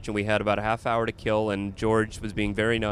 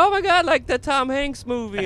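high-pitched, gushing mock delight; imitating delighted waitress; 13 semitones higher than usual. Comic voicing waitress who got his joke, delighted. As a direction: squeal it as the tickled waitress. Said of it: "she starts laughing".

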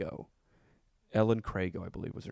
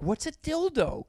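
mock loud question; 5 dB louder, slower than usual. Asking loudly in class.

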